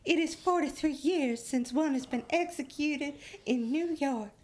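high-pitched, hammed-up Southern belle reading; Scarlett O'Hara. Host quoting the Times to co-hosts, mock belle voice. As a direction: read the editorial like a melodramatic belle. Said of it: "You sound like Scarlett O'Hara".

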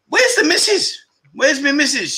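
high, playful call for his wife. Real, not acted. The host looks for his wife in the chat, playful high call. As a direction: sing out for the missus who hasn't shown up.

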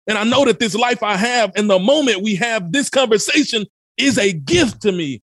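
high, driving call to wake up, shouting the key lines; fired-up stage-style urgency; urgent motivational voice. Real, not acted. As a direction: preach it like your life depends on it. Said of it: "I talk like this on a regular".

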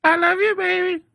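high mock lover voice. Answering the lyric, high.

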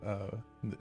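ordinary talk, nothing marked

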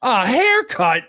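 high, loud, drawn-out Irish lilt; mock amazement in an Irish accent. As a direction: exclaim it in a put-on Irish accent. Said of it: "I'm doing an Irish accent".

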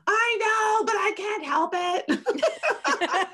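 high, slow laughing wail; a high, half-laughing cry of "I know, but I can't help it"; laughing whine. Retold or imitated. Whining to her best friend.

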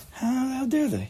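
high, hammed-up indignation without volume; squeaky-high mock offence; mock outrage. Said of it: "you start screaming and yelling".

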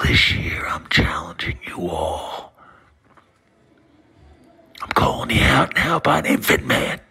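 ordinary talk

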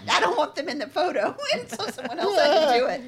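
loud, high-pitched, laughing through the words. Real, not acted. Defending herself to a friend teasing her hands, laughing loudly. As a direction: protest loudly while cracking up.

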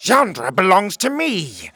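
high, loud, possessive cry; shrill royal defiance; shrill screaming king. Acted. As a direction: shriek it like an outraged king. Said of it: "screamed the king's shrill voice".

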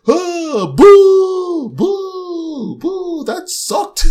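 high, loud mocking boos, stretched out; hammed-up grumpy old critics booing; grumpy Muppet hecklers. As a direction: heckle like the old men in the balcony. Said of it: "you see the two old guys in the theater".

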